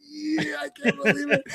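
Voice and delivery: high, hushed fanboy disbelief; small, high-pitched thrill, acted out; retold fan awe. Fan re-enacting his reaction to Luke Cage, excited.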